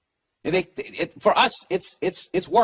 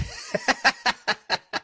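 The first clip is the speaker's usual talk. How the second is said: high laugh; 7 semitones higher than usual. Real, not acted. Laughs at ghost idea.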